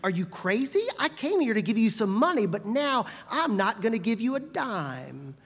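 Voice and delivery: indignant tirade, fast and loud; furious outburst. A narrator's reading. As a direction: sputter with outrage. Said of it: "Paul snapped back".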